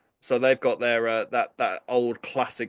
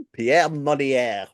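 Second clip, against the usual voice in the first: slow, drawn-out French accent; 3 semitones higher, 5 dB louder, much slower than usual. Shown as a demonstration. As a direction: savour the name in a French accent. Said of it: "without doing a French accent".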